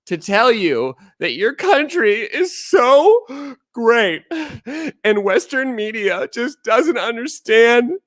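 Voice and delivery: high, put-on fawning voice; mock China apologist. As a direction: parody an eager apologist in a high voice. Parody of foreigners praising China.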